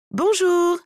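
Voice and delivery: high, loud hello; bright greeting. Acted. New client entering the salon, bright greeting. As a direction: sing out a greeting, pitched well up.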